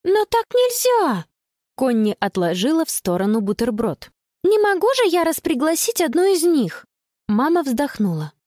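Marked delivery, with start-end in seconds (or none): 0.0-1.2 put-on high, drawn-out girlish objection; upset protest. Girl objects to leaving a friend out, high slow protest. 4.4-6.8 put-on high-pitched girl arguing; upset protest. Girl refuses to uninvite a friend, high indignant protest.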